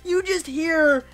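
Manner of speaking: high, drawn-out wail; emotional hype. Real, not acted. Fan gushing that his favourite is back, overcome. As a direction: stretch the words up high, overcome with joy.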